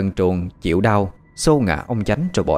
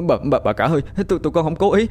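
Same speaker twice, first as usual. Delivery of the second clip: high, panicky stuttered pleading; terrified stammering; 10 semitones higher, faster than usual. A narrator's reading. Servants begging the dead mistress's forgiveness, terrified stammer. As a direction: beg forgiveness, tripping over repeated words. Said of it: "miệng lắp bắp".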